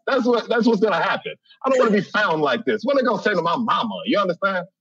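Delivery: usual speaking voice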